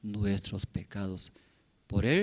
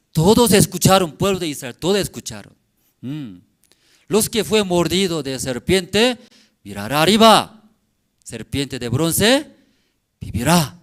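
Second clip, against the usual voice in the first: loud, rising preacher's call; fervent preaching; 4 semitones higher, 11 dB louder than usual. Acted. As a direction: preach it out to the brethren. Preaching to congregation. (predicación enérgica y fuerte)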